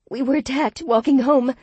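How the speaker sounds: high, loud rushed words; blurting. Acted. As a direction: blurt it out in panic. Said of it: "I blurted".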